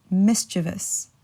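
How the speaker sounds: mischievous slowly, three syllables, MIS-chuh-vus; correct mischievous. Shown as a demonstration. Teacher introduces the last word, mischievous slowly as MIS-chuh-vus. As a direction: say mischievous with stress first and no ee sound. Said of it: "but it's actually mischievous".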